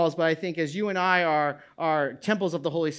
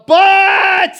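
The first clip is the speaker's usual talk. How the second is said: a sudden, much higher and louder shout on "But"; ominous but; 12 semitones higher, 15 dB louder than usual. Acted. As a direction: shout "But" as a dire warning. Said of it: "that's a bad but".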